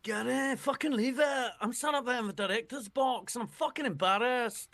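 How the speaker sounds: high-pitched, hammed-up Scottish accent; Billy Connolly-style Scottish. Podcaster attempts George Graham's team talk, in high Scottish accent. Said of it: "I just went to Billy Connolly because I couldn't think of a Scottish accent".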